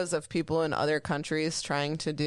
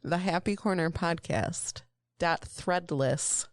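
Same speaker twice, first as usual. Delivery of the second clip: slow, over-enunciated plug; crisp announcer-style sponsor plug; careful enunciation; slower than usual. Shown as a demonstration. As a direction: spell out every syllable of the sponsor read. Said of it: "Take two".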